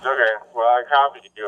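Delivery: normal talking voice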